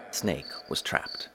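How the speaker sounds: ordinary delivery, nothing marked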